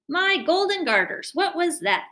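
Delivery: loud, high startled cry; a king jolted by the crash, yelling out; loud high-pitched royal alarm. Acted.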